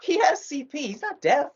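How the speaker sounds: high, mocking explanation; exasperated correction. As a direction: set her straight with a laugh. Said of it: "we're like".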